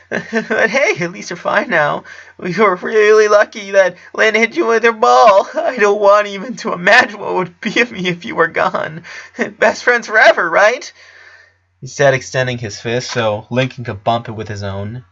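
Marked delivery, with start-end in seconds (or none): 0.1-10.9 put-on high, bright boy voice pushing cheer; relieved young friend trying to lift the mood; high eager kid voice; cheerful Clyde. A narrator's reading.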